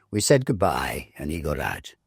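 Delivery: plain reading voice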